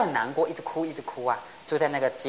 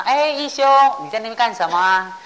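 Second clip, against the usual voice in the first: high, loud call to a distant novice; calling out from afar; 7 semitones higher, 8 dB louder than usual. Retold or imitated. Master calls out to young Ikkyu from a distance, a mock loud call. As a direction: call his name across the hall, then ask.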